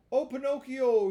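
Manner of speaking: high, loud tearful cry. A narrator's reading. Fox begging Pinocchio for alms, tearful high plea. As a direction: beg through mock tears. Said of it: "he cried in a tearful voice".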